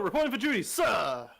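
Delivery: high, loud soldier shout; crisp loud soldier report. Pretend. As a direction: bark it like a recruit on parade. Answers the Commander like a soldier.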